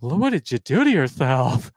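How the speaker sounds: lightly voiced camp nurse, drawn out; flirty male nurse. Male nurse flirting with bloodied patient, lightly camp. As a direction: coo at him like a flirting nurse. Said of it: "He says".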